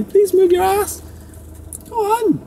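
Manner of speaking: high, slow pleading to the cat; sweet talk the cat out of the way; coaxing the cat. Real, not acted. Driver begging his cat to move, high coaxing voice.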